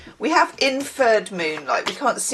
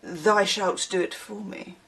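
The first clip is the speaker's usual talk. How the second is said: a hint of a prim Victorian son, slow and formal; mock Victorian speak; 6 dB quieter, slower than usual. Imitating an online son scolding his mother, mildly formal. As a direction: lightly put on old-fashioned formality. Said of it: "mostly he argues in Victorian speak".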